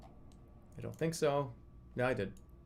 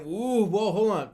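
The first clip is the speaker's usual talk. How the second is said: higher, louder hammed-up "ooh, whoa"; mock surprised chat; 7 semitones higher, 5 dB louder, slower than usual. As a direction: play the startled viewers, half-mocking. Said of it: "People are saying".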